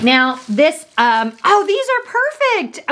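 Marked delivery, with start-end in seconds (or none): 1.4-2.9 high, thrilled squeal of delight. Real, not acted. Unwrapping a friend's gift, delighted high squeal. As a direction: gush over the perfect gift.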